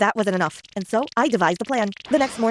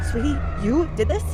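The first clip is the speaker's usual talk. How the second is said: shocked; 4 semitones higher than usual. A narrator's reading. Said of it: "asked Dad in shock".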